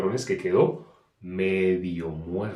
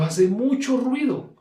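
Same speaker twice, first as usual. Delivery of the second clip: much higher, slow squealing pig voice; imitating a squealing pig; 12 semitones higher, 4 dB louder, slower than usual. Preacher mimics the pig squealing at the slaughterhouse. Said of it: "por tanto que grita y grita".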